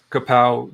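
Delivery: loud punchy kapow; kapow sound effect. Acted. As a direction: blurt it like a gunshot.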